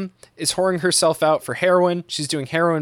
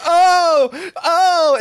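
high, loud mock moans; hammed-up drawn cries, pitched way up; imitated moan; 7 dB louder than usual. Wife reacting to Visitor Q in the film, loud mock moaning. Wife's reaction in retold sex scene.

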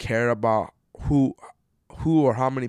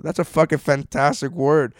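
ordinary talk

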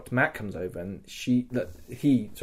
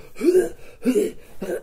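strained laugh. Pretend. Released after being stabbed.

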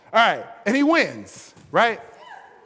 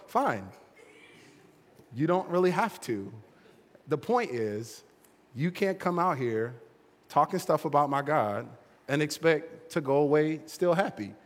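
calm David; 6 semitones lower, 6 dB quieter than usual. Acted. David answers Goliath calmly.

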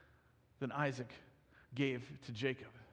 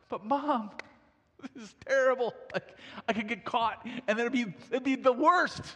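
whiny-style protest, higher and emphatic; protesting son; 4 semitones higher, 4 dB louder than usual. Acted. Son protesting to his mother's scheme, dismayed and emphatic. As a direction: object to mom, punching the last word.

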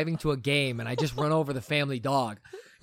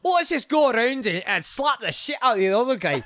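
put-on lightly done Scottish accent, raised pitch; 9 semitones higher, 4 dB louder than usual. Joking about a rough family game, in a mock Scottish accent. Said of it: "that was Scottish".

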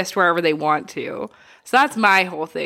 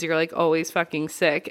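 ordinary delivery

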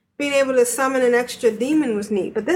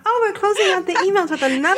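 high, fast, laughing hype; over-the-top enthusiasm; 7 semitones higher, faster than usual. Shown as a demonstration. Host redoing her flat email announcement for her co-hosts, mock-enthusiastic. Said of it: "did I not say that enthusiastically enough".

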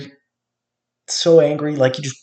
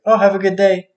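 sugary mock greeting; 6 semitones higher than usual. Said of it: "they're like".

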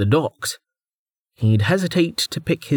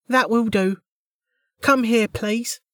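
high, bored woman official; 11 semitones higher than usual. A narrator's reading. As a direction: summon him like a clerk. The official calls him forward.

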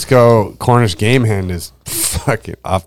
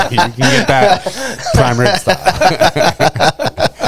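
laughing; 8 semitones higher, much slower than usual. Real, not acted. Laughing mid-joke.

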